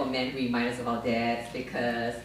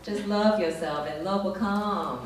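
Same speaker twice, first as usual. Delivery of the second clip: a higher, breezy life-coach voice; mock life coach; 4 semitones higher than usual. Life coach soothing her about dating, lightly mocked. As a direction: lightly imitate a chirpy advisor. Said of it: "She's always giving me dating advice".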